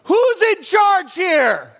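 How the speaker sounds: high barked demand from a police officer; cop's shout. Retold or imitated. Cop shouting at the kids on the rocks, loud and stern. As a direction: bellow it like a cop taking control. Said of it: "he looked at me and goes".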